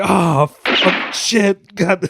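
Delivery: high-pitched imitation of dismayed swearing; mock distress. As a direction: act out a comic's horrified realization. Acts out the comic's distressed swearing.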